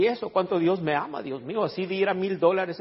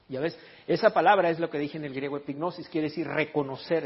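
ordinary talk, nothing marked

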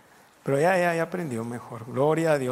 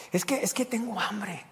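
put-on desperate little boy; 5 semitones higher than usual. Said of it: "se desesperó y dijo".

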